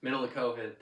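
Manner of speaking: ordinary talk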